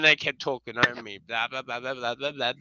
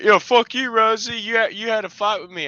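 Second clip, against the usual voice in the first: high, loud, fast mock accusation; mock angry shout; 10 semitones higher, 7 dB louder than usual. Offended stranger accuses Rosie, mock angry shout. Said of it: "they're angry at me, like".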